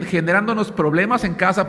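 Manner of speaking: ordinary talk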